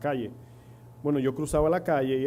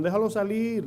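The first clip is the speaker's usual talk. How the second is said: mock friends' yell; 8 semitones higher than usual. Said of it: "me gritaban".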